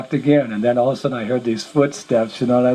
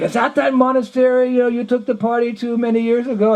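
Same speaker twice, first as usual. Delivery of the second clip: put-on voice, much higher throughout; high-pitched questioning; 11 semitones higher than usual. Monks questioning him about an old party, high-pitched questioning. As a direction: ask it in a high, acted-out voice.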